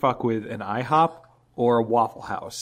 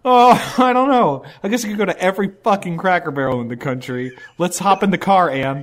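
high, loud goofy retiree voice; hammed-up retiree; 6 semitones higher, 6 dB louder than usual. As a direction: play a loud, bored pensioner. Playing a bored new retiree.

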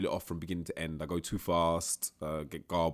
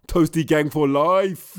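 sign-off catchphrase; 8 semitones higher, 11 dB louder, much slower than usual. Retold or imitated. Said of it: "I was like".

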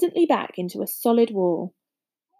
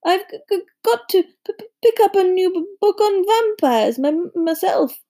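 high-pitched, terrified stuttering; jittery, halting timid professor; frightened stammer; 10 semitones higher, 4 dB louder than usual. A narrator's reading. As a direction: stammer through it, scared of the thought. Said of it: "He looked terrified".

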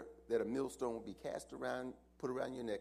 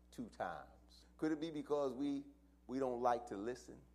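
ordinary talk

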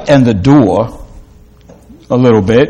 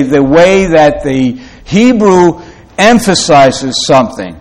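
ordinary talk

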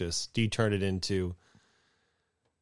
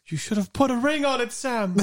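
very high, loud joking Frodo; 7 dB louder than usual. Acted. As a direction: shriek it high like a silly hobbit.

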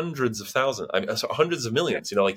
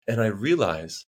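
ordinary talk

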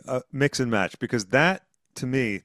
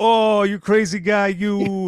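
higher, louder, slower teasing voice; mock affectionate brothers; 8 semitones higher, 5 dB louder, slower than usual. Joking about the film's ending, a hammed-up higher brotherly tease. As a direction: ham up the cheesy brotherly love. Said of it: "And they go".

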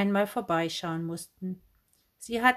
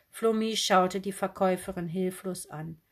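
usual speaking voice

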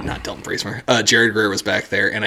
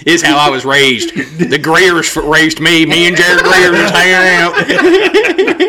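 raised, hammed-up Southern twang; Charlie; 5 semitones higher, 4 dB louder, slower than usual. Friend defending his upbringing, mock Southern drawl. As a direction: drag out the last words in country twang. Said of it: "imagining Charlie being like".

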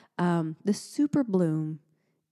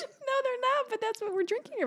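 high laughing defense; 5 dB quieter than usual. Real, not acted.

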